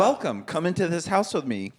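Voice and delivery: high, sing-song realtor greeting; mock realtor welcome. Mocking realtor drone tours, high welcoming voice. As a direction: invite them in like a drone video. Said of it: "They're like".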